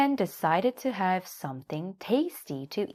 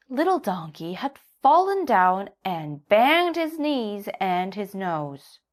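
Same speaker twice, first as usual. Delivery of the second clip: usual speaking voice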